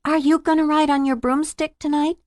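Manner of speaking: lightly voiced curious little boy.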